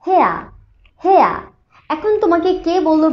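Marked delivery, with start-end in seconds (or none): 0.1-1.3 "হেয়া" as h plus "eh-uh", falling; pronunciation model. Shown as a demonstration. Teacher modelling the word for students, "হেয়া" pronounced "heh-uh". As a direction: say "হেয়া" like British "hare", r silent. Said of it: "ওয়ার্ডটা হবে".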